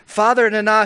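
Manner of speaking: "Father Nana" proclaimed loud and high; a booming "Father Nana"; grand invocation. Acted. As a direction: call out "Father Nana" like a herald. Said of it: "How many times do you have to say".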